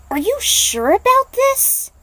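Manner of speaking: pleading, high-pitched and urgent; anxious young woman. Acted. Aiko anxiously questions Nightwolf about his tribe, high and urgent.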